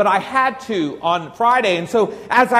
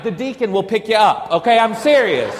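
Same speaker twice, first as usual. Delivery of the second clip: ordinary talk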